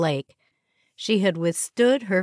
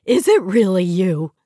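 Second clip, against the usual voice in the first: big, booming disbelief; sorority sister greeting Marianne, loud; loud excited greeting; 2 semitones higher, 8 dB louder than usual. Acted. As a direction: gush as if you can't believe it. Old friend squealing greeting.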